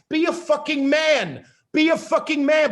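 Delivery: angry demand. Real, not acted. Angry rant at men.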